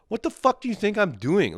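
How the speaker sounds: high-pitched indignant retort; exasperated. Acted. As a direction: protest in incredulous outrage.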